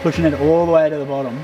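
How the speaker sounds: ordinary talk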